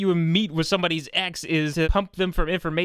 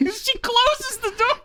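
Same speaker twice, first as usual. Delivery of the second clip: laughing; slower than usual. Real, not acted.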